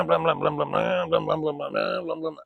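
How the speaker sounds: stuttering run of blahs, tapering off; rapid mouthed motor noise; imitated engine-brake noise. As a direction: sputter like a truck's engine brake.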